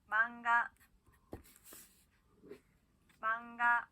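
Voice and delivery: 망가 with a clear ng before 가; model word. Shown as a demonstration. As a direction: say 망가 with the nasal as ng. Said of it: "따라할게요".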